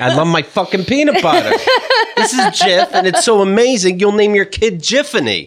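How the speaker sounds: hammed-up furious peanut butter pitch; mock angry ad read. Parodying the angry voice-actor take, mock furious. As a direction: spit the ad out like a mad announcer. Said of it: "a little less angry".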